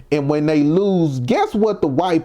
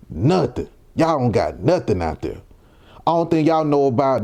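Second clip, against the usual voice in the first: normal talking voice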